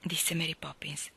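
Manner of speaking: plain reading voice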